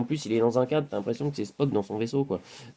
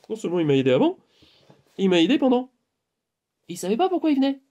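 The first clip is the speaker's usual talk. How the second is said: excited; 9 semitones higher, faster than usual. Retold or imitated. Retelling his own excited words.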